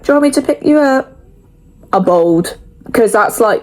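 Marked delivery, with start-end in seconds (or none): 0.0-1.2 high, quick gentle grandma voice; lightly voiced caring nan; nan's caring question. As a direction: mimic nan offering a lift. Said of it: "She's like".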